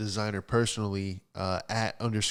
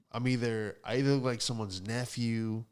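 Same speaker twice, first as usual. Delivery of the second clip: ordinary delivery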